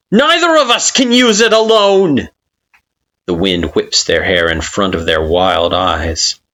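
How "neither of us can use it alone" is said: high, loud insistent shout; furious yell. Acted. Kara insisting over the wind, furious yell. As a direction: force it on them.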